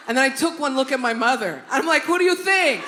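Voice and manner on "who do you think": high, loud comeback question; defiant retort. Retold or imitated. Daughter retorting to driver beside mother, defiant shout. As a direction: fire it back as if obvious.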